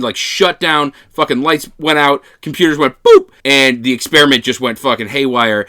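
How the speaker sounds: ordinary talk, nothing marked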